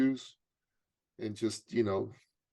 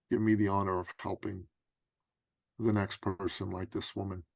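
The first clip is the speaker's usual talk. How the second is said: humble prayer; 3 semitones lower, 3 dB quieter than usual. Retold or imitated. Man asks God for the honor of helping, humble prayer. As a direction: ask humbly, leaving long pauses. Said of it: "I asked God".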